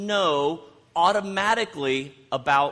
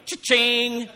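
bright jackpot cry, higher and louder; playful "Cha-ching!" after the gambling joke; cash register cha-ching; 8 semitones higher, 6 dB louder than usual. Pretend. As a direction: sing out the sound of winning money.

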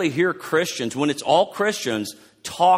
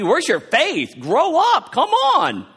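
much higher, louder exasperated scold; 13 semitones higher, 5 dB louder, slower than usual. Shown as a demonstration. Pastor showing how Jesus does not sound, high loud scold. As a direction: bark it like an impatient coach. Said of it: "not asking like it sounds like me".

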